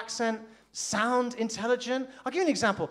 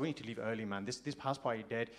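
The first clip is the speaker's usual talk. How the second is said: mock bored mumble; 11 semitones lower, 13 dB quieter than usual. Quoting himself, bored at party.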